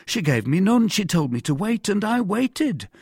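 high-pitched voice of an eager-to-explain husband; high, earnest character voice; deferential mercer. Acted. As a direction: answer the interrogator dutifully, pitched well up. Voices Bonacieux answering Cardinal.

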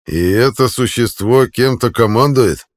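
loud scornful sneer, slow; contemptuous sneer. A narrator's reading. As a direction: sneer down at him loudly. Centurion mocking the hero.

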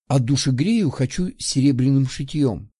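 usual speaking voice

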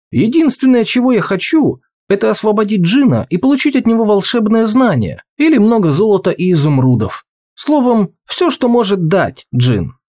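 put-on a keen young man pleading his wish; eager youth.

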